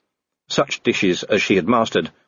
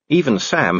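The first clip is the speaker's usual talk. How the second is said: plain reading voice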